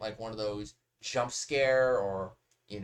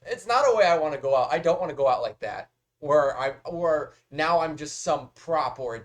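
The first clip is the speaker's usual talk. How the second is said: loud protest; 3 semitones higher, 8 dB louder than usual. Real, not acted. Reacting loudly to bone decorations.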